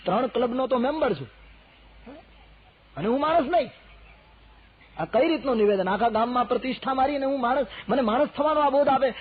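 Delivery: high, loud offended merchant; indignant merchant protesting; upset rich merchant. Acted. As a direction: play the insulted rich man. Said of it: "પેલો શેઠ હેરાન થઈ ગયો".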